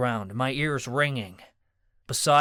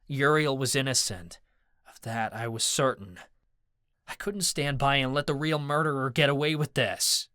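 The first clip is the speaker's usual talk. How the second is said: ordinary delivery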